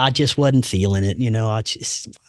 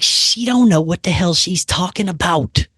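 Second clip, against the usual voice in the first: annoyed complaint; 6 semitones higher than usual. Retold or imitated. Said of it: "I was like".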